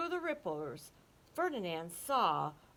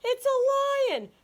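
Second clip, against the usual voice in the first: much higher and louder, slow frightened call; frightened cry; 11 dB louder, slower than usual. Acted. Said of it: "called Ferdinand in fright".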